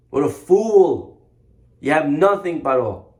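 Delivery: ordinary delivery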